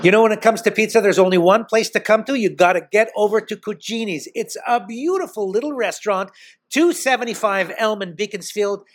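live ad read. Acted. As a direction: sell the restaurant like a radio spot. Host does live restaurant plug.

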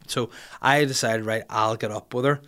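ordinary delivery

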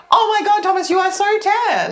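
retold gushing blurt, pitched high. Woman retelling her blurt to Thomas in an open-plan office, exclaiming. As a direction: exclaim it high, re-enacting your own tactless outburst.

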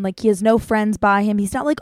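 usual speaking voice

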